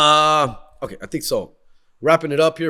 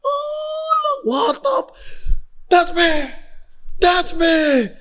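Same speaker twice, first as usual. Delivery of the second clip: ecstatic winner; 13 semitones higher, much slower than usual. Retold or imitated. Overjoyed winner, crying shout.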